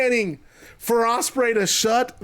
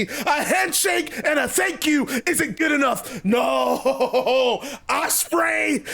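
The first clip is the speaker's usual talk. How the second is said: indignant promo climbing higher, then slowing to a verdict; Jericho, worked-up refusal; 5 semitones higher than usual. Acted. Wrestler rejects a handshake, wants rival gone, indignant building ultimatum.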